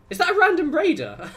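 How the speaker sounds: high, loud, laughing disbelief; real surprise. Real, not acted. Player caught out by a random raider, surprised. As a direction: yelp it, breaking into a laugh.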